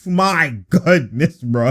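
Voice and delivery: normal talking voice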